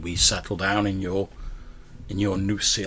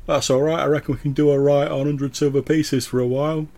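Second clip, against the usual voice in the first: cheeky voice from the crowd; 7 semitones higher, 8 dB louder than usual. Acted. Voice from the back of the crowd.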